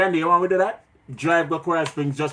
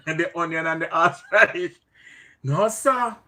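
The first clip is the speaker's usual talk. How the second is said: normal talking voice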